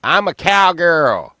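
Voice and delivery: loud, high, drawn-out mock cowgirl; mock cowgirl holler. Mimicking ladies running around in mom pants, mock cowgirl holler. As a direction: holler it like a woman showing off. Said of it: "ladies running around in mom pants going".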